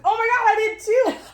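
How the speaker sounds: high excited exclamation; thrilled shared discovery. Retold or imitated. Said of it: "It's like".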